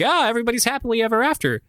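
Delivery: high, quick mock-cheery lilt; sing-song happily-ever-after voice; mock happy ending. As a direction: gush it like a fake fairy-tale ending. Voicing a sugary fairy-tale ending.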